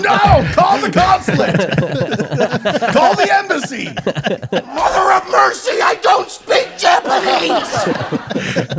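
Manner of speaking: high, loud laughing panicked shout; Chris Farley panic. Retold or imitated. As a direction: scream for the embassy in hammed-up panic. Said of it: "he does not speak Japanese".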